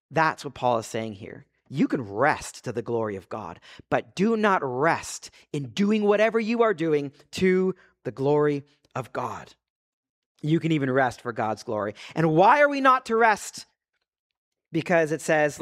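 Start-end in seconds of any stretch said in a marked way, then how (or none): none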